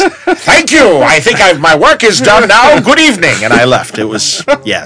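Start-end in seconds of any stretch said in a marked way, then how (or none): 0.0-3.4 higher, slow, pompous farewell; bow out like a ham actor; grand exit. Pretend. Embarrassed fan exits the table, pompous and hammy.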